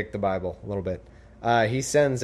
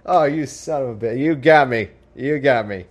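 laughing; 5 semitones higher, 7 dB louder, slower than usual. Retold or imitated. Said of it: "And guy's like".

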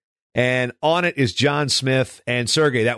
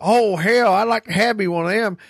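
attempted Oklahoma accent; 8 semitones higher than usual. Podcaster imitating the wrestler who signed his poster, attempted Oklahoma accent. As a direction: imitate an Oklahoma man, accent not quite right. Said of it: "I can't quite do his Oklahoma accent".

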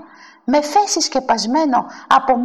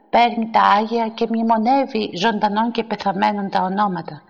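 ordinary delivery

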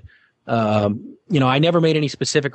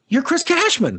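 high-pitched imitated fan voice; excited fan. Girl from the crowd recognizes the host, high star-struck voice. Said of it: "comes out of the crowd and goes".

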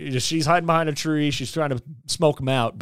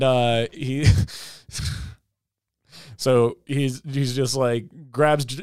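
ordinary delivery

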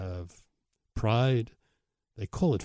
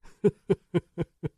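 short laugh; 8 semitones higher than usual. Real, not acted. Laugh after the threat.

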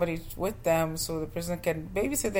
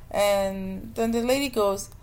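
ordinary talk, nothing marked

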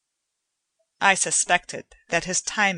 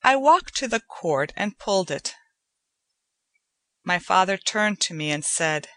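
usual speaking voice

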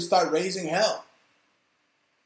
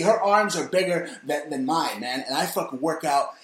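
normal talking voice

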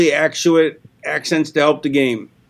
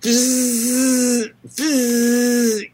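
hissy fizz noise; 6 semitones higher than usual. Pretend. Performing fan's noise.

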